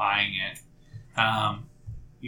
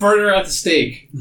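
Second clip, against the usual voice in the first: high, loud heckle from a crowd; acted rabble-rousing cry; angry mob shout; 9 semitones higher, 7 dB louder than usual. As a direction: yell it out as an incensed villager. Angry mob voiced by game master.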